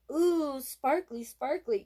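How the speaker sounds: high, slow, drawn-out coo of wonder; small boy dazzled by his bracelet; delighted little boy. A narrator's reading. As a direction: savor each word with awe. Boy admiring bracelet again.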